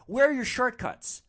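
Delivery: high, loud mocking demand; taunting passenger. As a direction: imitate a rider jeering at the driver. Passenger taunts driver in traffic.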